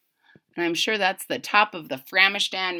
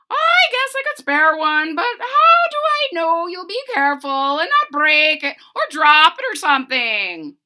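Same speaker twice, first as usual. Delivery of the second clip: high, loud doubtful giant bird; high bird lady, wary; 7 semitones higher, 7 dB louder than usual. Acted. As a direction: play the bird unsure of them.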